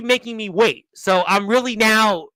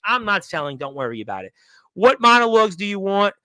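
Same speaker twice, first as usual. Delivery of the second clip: ordinary talk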